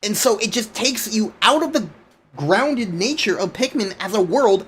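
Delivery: normal talking voice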